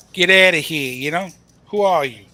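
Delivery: loud mock Italian-American accent, tough-guy Sopranos style. Poker co-host mocks a player's shove in a loud mock Italian accent. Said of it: "It's my best Italian voice".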